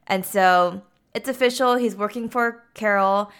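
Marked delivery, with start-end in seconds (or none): none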